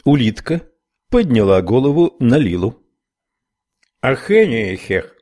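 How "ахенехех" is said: thick mumbled Irish speech through gum; garbled Irish brogue, mouth full; Irish accent. Acted. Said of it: "с сильным ирландским акцентом". (ирландский акцент, невнятно)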